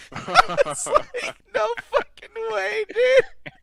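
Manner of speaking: very high, laughing disbelief; laughing squeal. Real, not acted. Laughing about the clip.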